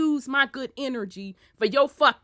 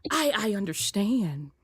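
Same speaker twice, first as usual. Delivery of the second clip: soft, over-proper parent at school; mock mom's polite, careful school manners; real proper mom; 2 semitones lower, 5 dB quieter than usual. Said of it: "They get real proper".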